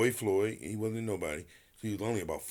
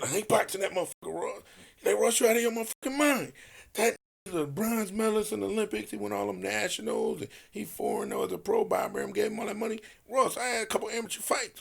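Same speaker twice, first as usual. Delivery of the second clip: higher, quick panicked pleading; frightened protest; 11 semitones higher than usual. Retold or imitated. Ted refusing to box the Olympic medalist, high panicked protest. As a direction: beg your friend not to make you fight.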